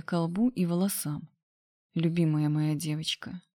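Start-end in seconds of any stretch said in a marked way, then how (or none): none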